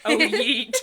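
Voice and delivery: playful joking cheer; mock cheer. Real, not acted. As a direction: toss it off gleefully.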